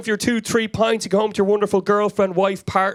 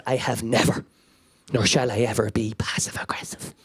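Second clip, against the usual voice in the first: icy denial; 10 semitones lower, 5 dB quieter than usual. Retold or imitated. Mum icily denies being passive aggressive.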